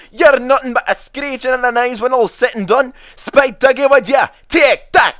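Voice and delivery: high, loud Scottish tirade ending in a shout; angry outburst. Acted. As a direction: tell off the van then shout. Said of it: "Donald burst out".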